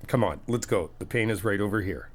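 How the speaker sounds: ordinary delivery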